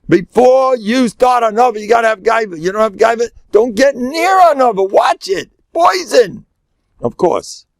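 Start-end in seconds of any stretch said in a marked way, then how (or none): 0.1-6.4 high, emphatic preaching; urgent warning. Real, not acted. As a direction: sound the alarm, pitched far up and insistent. Urgent warning against humility.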